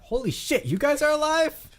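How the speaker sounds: very high, loud exclamation; astonished guard. Acted. Game master quotes the guard's exact words, astonished. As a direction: blurt it out in total disbelief. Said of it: "His exact words are".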